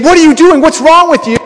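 high-pitched, worked-up telling-off; mock angry scolding. Imagined Jesus coming at someone with the whip, mock angry. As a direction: act out an angry confrontation, voice jumping up. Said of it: "he's not coming to you angry saying".

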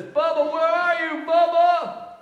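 desperate shout. Retold or imitated. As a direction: yell out searching for Bubba. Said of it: "he says".

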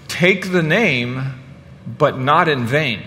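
very slow, phrase by phrase; deliberate title announcement. Real, not acted. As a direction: spell out the title slowly, with a pause midway.

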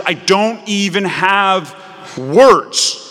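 a parent lost for words at the mess; astonished parent. Acted. Parent walking in on the child's mess, speechless and astonished.

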